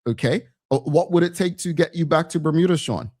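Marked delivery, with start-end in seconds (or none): none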